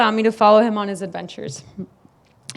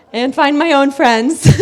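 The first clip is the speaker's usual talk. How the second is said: normal talking voice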